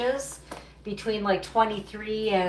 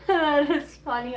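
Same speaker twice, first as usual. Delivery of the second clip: laughing; 6 semitones higher, 4 dB louder than usual. Real, not acted. Laughing at a chat comment.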